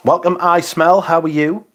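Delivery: high, loud welcome; bright greeting. Real, not acted. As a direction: greet them with a big lift. Greeting a chat member.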